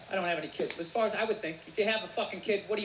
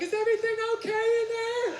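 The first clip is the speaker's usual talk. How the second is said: concerned wife; 9 semitones higher, 4 dB louder than usual. Retold or imitated. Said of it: "my wife's always just like".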